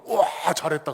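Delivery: loud burst of praise; acted delight. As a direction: boss exclaiming in mock amazement. Boss demon praises third underling.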